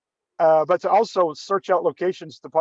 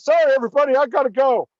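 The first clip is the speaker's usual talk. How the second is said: higher and louder hasty goodbye; hurried exit; 8 semitones higher, 4 dB louder, faster than usual. Retold or imitated. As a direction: announce the getaway in a rush. Dave leaving in a hurry.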